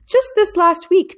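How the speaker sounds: eager. A narrator's reading. Father remembering eagerly.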